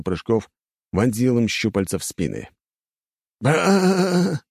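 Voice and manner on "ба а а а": drawn-out death cry, higher and louder; a long dying wail; dying groan. A narrator's reading. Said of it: "издали они предсмертные хрипы".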